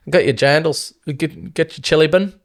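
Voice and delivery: light, lightly put-on Kiwi accent; a playful, half-hearted Kiwi accent. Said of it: "I'm trying to do a good Kiwi accent".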